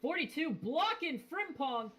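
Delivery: rising urgency as the cars close up; building excitement. Real, not acted. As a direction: race call climbing steadily in pitch. Race call as a block develops.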